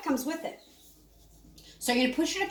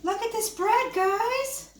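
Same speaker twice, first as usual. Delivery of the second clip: high, thrilled show-off; excited high; 9 semitones higher, slower than usual. Real, not acted. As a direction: gush over the bread, pitched up. Showing off fresh bread.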